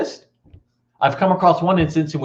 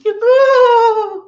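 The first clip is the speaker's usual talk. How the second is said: long high loud squeal of dismay; sustained, high-pitched mock cry; mock girlish wail; 7 dB louder than usual. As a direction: wail like a horrified girl. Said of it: "every girl in the room does this".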